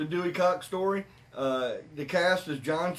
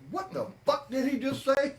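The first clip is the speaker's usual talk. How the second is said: high, baffled outburst with a laugh; Dewey asking his band, high and baffled; 10 semitones higher than usual. Retold or imitated. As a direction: sound totally baffled by what he heard. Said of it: "Dewey looks at the rest of his band and goes".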